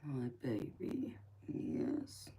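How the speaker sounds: tender whisper. Real, not acted. Whispering to baby.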